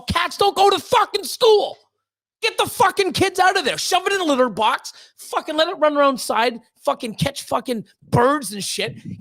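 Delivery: real angry rant, voice pushed up in bursts; heated rant. Real, not acted. Streamer ranting at parents of a cat-identifying kid, heated. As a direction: get worked up and let it rip. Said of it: "I'm getting heated".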